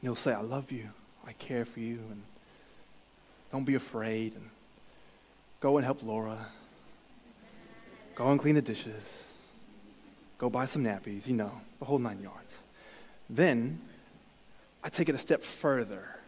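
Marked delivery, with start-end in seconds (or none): 0.3-11.3 gentle voice of God. Retold or imitated. Voicing God's gentle answer.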